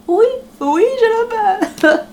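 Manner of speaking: high, drawn-out swooning yes; hammed-up squeal of a thrilled bride; mock gushing bride. Joking about wedding vows, mock gushing bride.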